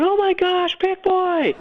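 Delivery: very high mock-horrified exclamation; imitated shocked cry. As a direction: mimic panicked onlookers in a high voice. Acts out hosts' shocked reaction again.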